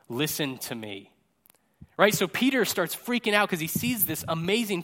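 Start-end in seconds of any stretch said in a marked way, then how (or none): 0.1-1.1 lightly voiced God, low and slow; a low, measured divine command. God instructing the disciples, lightly solemn. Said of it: "God, like, speaks and says".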